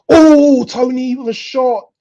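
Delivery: sudden high-pitched outburst; real loud football excitement; excited match reaction. Real, not acted. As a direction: cry out as the shot goes in. Breaks off for live action.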